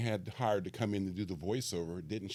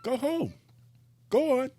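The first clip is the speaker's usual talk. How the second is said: high, louder shooing voice; shooing Ferris; 6 dB louder than usual. Retold or imitated. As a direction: wave them out, cheeky and high. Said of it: "And he's like".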